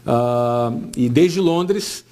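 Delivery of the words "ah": ordinary talk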